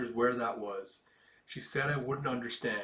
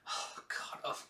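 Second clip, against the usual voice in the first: breathy groan; 8 dB quieter, slower than usual. Real, not acted. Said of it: "reacting to own mistake".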